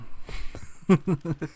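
high, quick run of chuckles. Real, not acted. Gamer laughing after shooting the enemy, satisfied chuckle. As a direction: laugh at a job done.

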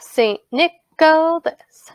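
"Saint Nicholas" slowly in separate beats; syllable by syllable. Shown as a demonstration. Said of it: "listen very carefully to how I say those".

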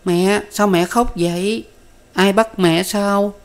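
put-on high, slow small-child voice; whimpering child. A narrator's reading. Toddler tugs mother's dress asking why, whimpering child's voice. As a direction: ask slowly like an upset toddler. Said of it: "níu áo khóc bều bào hỏi rằng".

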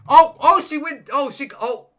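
jolted by a fall on stage; startled cry as she tumbles; shocked gasp. Real, not acted. Contestant falls off stage.

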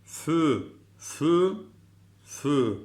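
light "fü" with fronted ü; thin "fü". Shown as a demonstration. Teacher models a thin letter, light "fü". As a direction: keep "fü" thin, never heavy. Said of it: "İnce harf".

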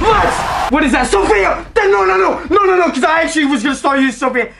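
high shouting, tumbling into fast babble; excited astonishment. Real, not acted. Player amazed by the Sophia pull, high excited shouting. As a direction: blurt it out in high disbelief.

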